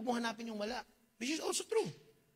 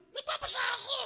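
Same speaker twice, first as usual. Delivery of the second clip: mock high shout. Own retort in the story.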